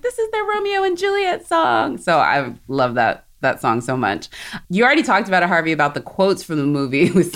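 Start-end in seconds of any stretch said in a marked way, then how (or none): 0.0-2.0 swooning squeal, pitched way up; gushing high voice. Retold or imitated. Fan gushing over the couple's song in a squeal. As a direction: gush about the lovers in a squeal. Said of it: "I was always just like".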